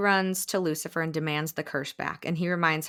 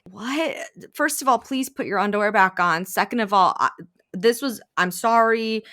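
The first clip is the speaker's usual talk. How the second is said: mock-startled, louder protest; flustered disbelief; 4 semitones higher, 6 dB louder than usual. Stunned woman answers her date's mom, flustered. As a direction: play taken aback and stammering.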